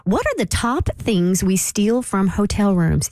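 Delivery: normal talking voice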